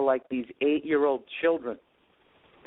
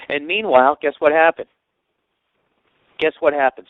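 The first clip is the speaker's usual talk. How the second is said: teasing build-up; 3 semitones higher, 11 dB louder than usual. Real, not acted. Teasing the listener, louder.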